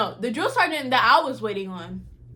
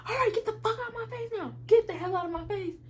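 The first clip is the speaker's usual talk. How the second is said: high, fast, softer mocking snap; fed-up order; 10 semitones higher, 5 dB quieter, faster than usual. Juicy dismissing the girl from her face, fast mock snap. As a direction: rattle it off like a fed-up sergeant shooing her away. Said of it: "Juicy was like".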